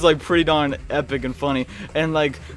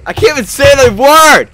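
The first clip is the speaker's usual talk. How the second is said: frustrated outburst; 9 semitones higher, 9 dB louder than usual. Real, not acted. Frustrated at mispronouncing name.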